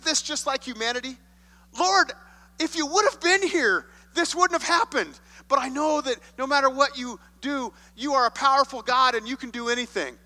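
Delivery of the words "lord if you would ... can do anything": higher, louder plea to the Lord; pleading Martha. Acted. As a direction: press Jesus with urgent faith. Voicing Martha's pleading complaint.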